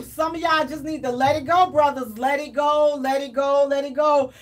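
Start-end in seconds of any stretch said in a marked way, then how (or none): none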